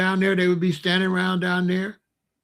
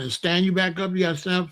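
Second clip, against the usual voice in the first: ordinary delivery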